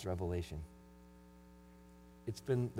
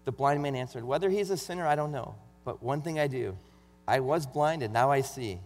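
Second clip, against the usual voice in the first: raised, sermon-style Bible reading; louder preaching read of John 9; scripture reading; 5 semitones higher, 7 dB louder than usual. Acted. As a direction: read the passage out like from the pulpit.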